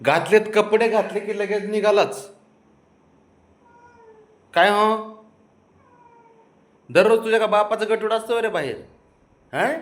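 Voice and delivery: high-pitched, lively teasing; playful scolding. Real, not acted. Teasing pet about rushing outside.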